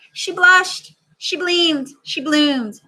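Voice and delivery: high, loud and delighted; growing joy. Acted. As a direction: let it burst with happiness. Child reads blooming joy.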